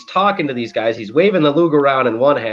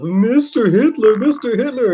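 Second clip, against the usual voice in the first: fawning fan; 12 semitones higher, 4 dB louder than usual. Retold or imitated. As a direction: gush like an adoring kid. Fan fawning over Hitler.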